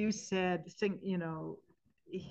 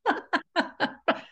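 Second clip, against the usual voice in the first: high bright laugh; burst of laughter; 11 semitones higher, 8 dB louder than usual. Real, not acted. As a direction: laugh out loud at the memory. Laughing at the dying woman's request.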